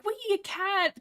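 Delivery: put-on high-pitched gleeful child voice; happy kids. Two Leg kids scoop up a cat, mock glee. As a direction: mimic little kids thrilled by a find. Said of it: "they're, like, all happy".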